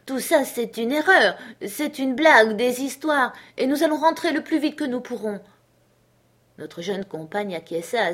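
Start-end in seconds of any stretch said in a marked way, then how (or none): 0.1-5.4 put-on higher, louder, pleading with the child, speeding up at the end; a nurse begging the little girl to believe her; an anxious housekeeper's hurried plea; stammering plea. A narrator's reading. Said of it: "balbutiante, elle suppliait l'enfant".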